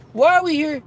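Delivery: high, loud why; fed-up loud question; exasperated rant. Real, not acted. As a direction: demand it of the crowd. Ranting at online harassers.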